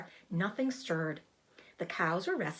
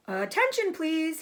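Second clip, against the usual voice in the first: much higher, louder call to attention; 9 semitones higher, 5 dB louder than usual. Acted. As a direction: shout across the barn. Wilbur repeats his call louder.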